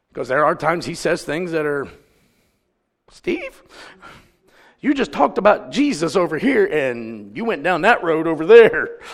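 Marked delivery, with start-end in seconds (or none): 3.1-9.0 comic disbelief, pitched up; mock scolding. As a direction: scold him playfully, rising to a loud finish. Mock scolding of Steve Harvey.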